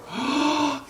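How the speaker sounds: high, soft, showy gasp; mock gasp. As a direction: gasp like the pitchman admiring. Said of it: "used car salesman voice".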